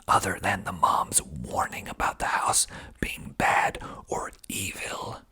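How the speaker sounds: usual speaking voice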